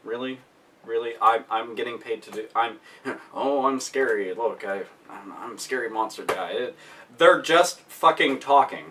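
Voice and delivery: a put-out monster muttering as it gives up; low mutter of a monster who quits; sulking monster. Retold or imitated. Monster complaining, voiced.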